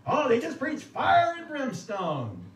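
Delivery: mock people scoffing at the old preachers; mock scandalized voice. Imitating people who complain about hellfire preachers, loud and high. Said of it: "You've heard that term".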